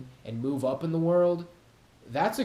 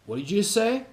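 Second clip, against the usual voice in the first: a raised, pushy challenge; squaring up to a guy at a party; mock confrontation; 7 semitones higher, 3 dB louder, faster than usual. Mock aggressive challenge.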